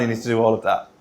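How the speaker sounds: usual speaking voice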